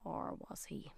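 a hushed, mysterious whisper; teasing whisper. Acted. Radio presenter teasing listeners about the mystery guest, in a hushed whisper. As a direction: drop to a secret whisper for the twist.

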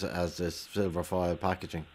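ordinary talk, nothing marked

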